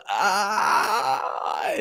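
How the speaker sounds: exasperated cry. Real, not acted. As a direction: cry out as if the figure physically hurts. Exasperated at the Thanos figure.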